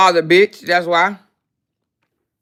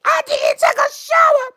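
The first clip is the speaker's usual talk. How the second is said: mocking raggedy voice. Mocking the rival streamer's raggedy voice, high whiny shouting. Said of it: "with her raggedy ass voice".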